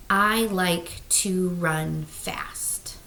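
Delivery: each word drawn out in a whisper; slow whispered sentence. Shown as a demonstration. Teacher whispers the sentence slowly for her class. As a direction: say it very slowly, hearing every word. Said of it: "I'm gonna say that sentence kinda slowly".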